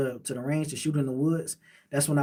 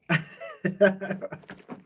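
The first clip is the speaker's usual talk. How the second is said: higher-pitched burst of chuckles; short laugh; 5 semitones higher than usual. Real, not acted. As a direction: break into a quick run of laughter. Laughs after explaining Zoes.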